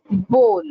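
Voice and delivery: "bowl" with a long O; "bowl" like hole, falling; correct pronunciation. Shown as a demonstration. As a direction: say "bowl" as BOHL. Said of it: "It's bowl".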